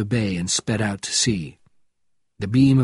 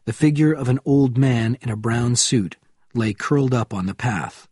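usual speaking voice

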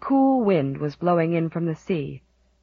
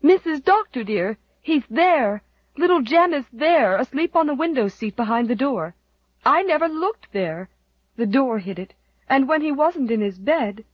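Susan's relieved cry; 6 semitones higher than usual. A narrator's reading. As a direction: blurt it out, overjoyed he's found. Susan finds the lost child.

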